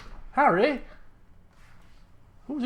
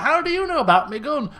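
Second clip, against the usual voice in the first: mock blurted question; 6 semitones higher, 3 dB louder than usual. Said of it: "asking questions like".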